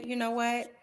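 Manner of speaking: slow, measured, tactful statement; softened, diplomatic message. Shown as a demonstration. Panelist showing colleague how an athlete could phrase her objection, tactfully. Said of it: "the way I just said that".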